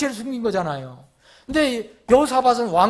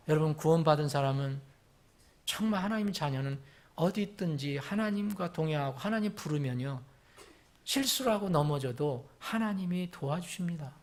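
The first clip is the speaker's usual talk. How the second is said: normal talking voice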